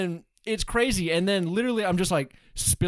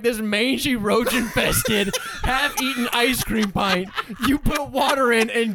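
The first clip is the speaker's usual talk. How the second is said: mock disgust; 5 semitones higher, 3 dB louder than usual. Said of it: "Roy's like".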